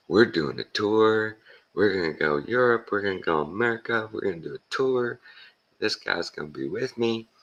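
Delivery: high, eager retold tour pitch; imitated eager promoter; excited announcing. Retells comedian announcing tour plans.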